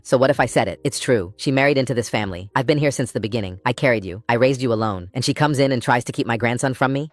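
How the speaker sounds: ordinary delivery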